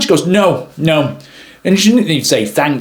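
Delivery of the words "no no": higher, dismissive mock refusal; passive aggressive. Photographer turns down help at her car, imitated passive-aggressive tone. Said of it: "very passive aggressive in her tone".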